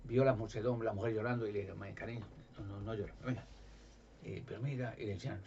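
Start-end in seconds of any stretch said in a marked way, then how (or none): 1.9-3.5 mucho más bajo y tierno; consuelo suave. Retold or imitated. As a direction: consuela con ternura. Jesús consuela a la viuda. (consuelo muy suave)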